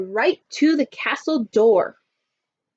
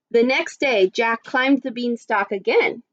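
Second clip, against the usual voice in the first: ordinary delivery, nothing marked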